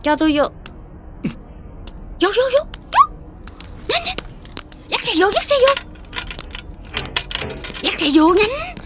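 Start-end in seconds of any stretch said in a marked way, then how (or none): 2.2-4.2 urgent hurrying. Acted. Woman hurries others inside. 4.9-8.8 urgent urging. Acted. Woman urges pushing bike in.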